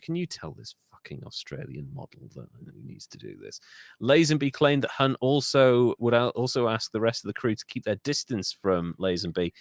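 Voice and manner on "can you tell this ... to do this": low, hushed imitation; muttered aside. Director to his assistant about the actor, low grumbling mutter. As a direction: mutter it under your breath to an assistant.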